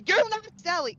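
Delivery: high startled yelp; retold scream. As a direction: shriek it in surprise. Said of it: "scream her way up".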